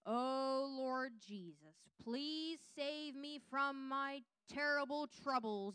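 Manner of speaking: slow, overblown pleading prayer, stretched out; mock grand prayer. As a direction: ham up a pompous church prayer, dragging every word. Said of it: "He doesn't need some".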